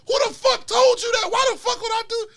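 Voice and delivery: high, loud frantic protest; hammed-up high-pitched outrage; freaking out, retold. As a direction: act out panicked indignation, voice way up. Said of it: "I started freaking the fuck out".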